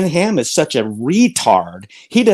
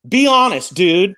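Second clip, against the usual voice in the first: angry demand; 3 semitones higher, 4 dB louder than usual. Real, not acted. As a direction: call him out, forcing the words. Pastor confronts opponent angrily.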